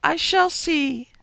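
very high, slow, loud wail; shrill drawn-out spirit guide. A narrator's reading. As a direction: pipe it high and stretched out. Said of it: "said the high voice".

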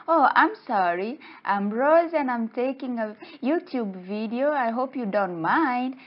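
high, polite pleading; acted apology. Acted apology to an offended fish.

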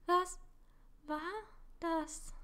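broken up word by word with pauses; out of breath after running, asking haltingly. A narrator's reading. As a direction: gasp each word out separately. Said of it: "fragte Emily stockend".

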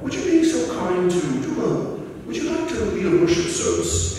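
high-pitched, badly faked English accent; lousy English accent. Mother-in-law asking for a backyard service, lousy English accent. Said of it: "that's my lousiest English accent".

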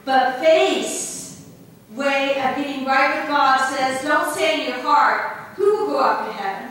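loud, ringing reading of the passage; scripture reading. Acted. Preacher reading Romans aloud to the congregation, loud and ringing. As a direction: proclaim the verses to the congregation.

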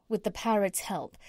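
ordinary delivery, nothing marked

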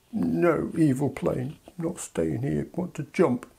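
put-on slow, soft sulky toddler. UK as toddler rejecting options, sulky grumble. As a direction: grumble it like a pouting kid. UK sulk.

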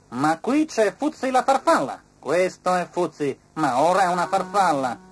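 inspector's voice. A narrator's reading. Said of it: "disse l'ispettore Formicone".

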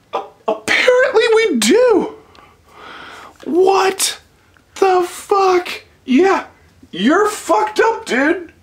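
high-pitched shocked disbelief at the cat. Real, not acted. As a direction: voice jumps way up in disbelief. Finds bird cat brought in.

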